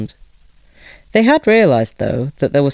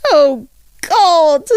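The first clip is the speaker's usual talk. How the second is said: high, louder anguished cry of a tearful mother; mother in tears; 4 dB louder than usual. A narrator's reading. Mother cries out over her son, high and anguished. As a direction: cry out in despair, voice near breaking. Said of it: "called his mother, who was already in tears".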